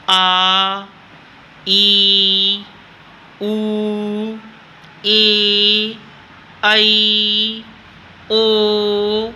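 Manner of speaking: "आ, ई, उ, ए, ऐ, ओ" each held long and steady with effort; drawn-out long vowels. Shown as a demonstration. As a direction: stretch every vowel, pushing it out. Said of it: "sir को जोर लगेगा बोलने में". (दीर्घ स्वर: "आ, ई, उ, ए, ऐ, ओ" ज़ोर लगाकर लंबा खींचकर)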